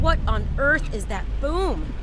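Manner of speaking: high, slow startled question; jolted by a sudden boom; a driver suddenly alarmed. Acted.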